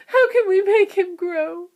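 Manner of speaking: high, loud tearful question; crying plea. A narrator's reading. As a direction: beg for help. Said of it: "cried Missus Teavee".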